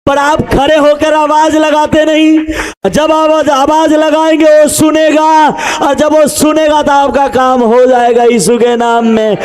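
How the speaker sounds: rally the congregation, stretching hallelujah; fiery preaching. Acted. Preaching climax. (Pastor मंडली को जोश से ललकारता है, ऊँची आवाज़ में)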